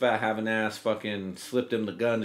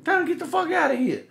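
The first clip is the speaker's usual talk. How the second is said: much higher, fast, squeaky put-on neighbor voice; mocking the neighbor; 3 dB louder than usual. Teller mimics the neighbor complaining about late teenage visitors, in a much higher, fast, mocking voice. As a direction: ham up a nosy neighbor's tattling in a high pitched voice. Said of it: "So he's like".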